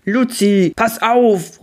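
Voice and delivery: high, loud alarm; panicked warning shout. A narrator's reading. Uncle sees the ferry ahead, shouts in panic. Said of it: "ruft Onkel Gabriel panisch".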